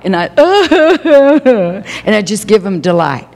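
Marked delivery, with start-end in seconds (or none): 0.3-2.0 high, bright burst of laughter; real laughter, much higher; delighted laugh. Real, not acted. Laughing at the kids' game.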